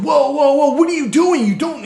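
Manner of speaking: high, loud whoa to stop her; alarmed call-out. Retold or imitated. To a woman jerking her dog, loud retold call-out. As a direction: shout her down, retelling the moment.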